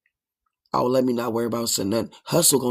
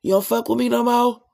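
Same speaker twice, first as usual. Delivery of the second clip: mock hurt friend; 8 semitones higher, 3 dB louder than usual. Cut-off friend asking why they aren't cool, high whiny mock plea. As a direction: whine like a dropped friend begging back in.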